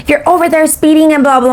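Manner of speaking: high, dismissive mimicry; mock teenage rant. Teenager accusing her of speeding, high mocking imitation. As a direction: parody the teen's nagging in a high voice.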